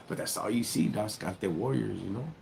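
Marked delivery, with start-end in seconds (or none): none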